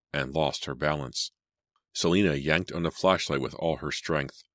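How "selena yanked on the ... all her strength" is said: plain reading voice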